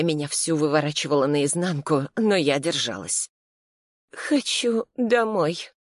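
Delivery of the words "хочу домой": slow, higher, drugged-sounding drone; like a sedated zombie, very slow; dazed, sluggish voice. Pretend. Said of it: "сказала заторможенным голосом". (заторможенным голосом, очень медленно)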